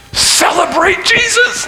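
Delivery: high, loud, drawn-out preaching cry; rousing shout. Real, not acted. Preacher urging Christians to celebrate, slow rousing shout. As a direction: shout it out to rouse them.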